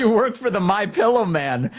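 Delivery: high-pitched mimicry of a dismissive politician; mock politician's retort. Host repeating a politician's jab at a reporter, mocking. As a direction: parrot the put-down in a high mocking voice. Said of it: "she says".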